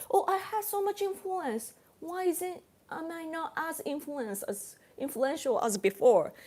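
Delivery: a hint of a puzzled, self-pleased party leader; smug politician. Party leader wondering why his posts stopped spreading, lightly mocking smug voice. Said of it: "He's thinking like".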